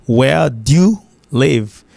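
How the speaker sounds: slow "Where... dyoo... live"; slowed demo of "Where do you live" with "dyoo"; one-syllable "do you". Shown as a demonstration. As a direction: space out "Where do you live", keeping "do you" fused. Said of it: "avec une seule syllabe".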